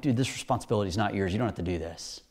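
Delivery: low, soft acted aside; casual reassurance. Jesus freeing Pilate from responsibility, low casual reassurance. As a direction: say it low and easy, letting him off.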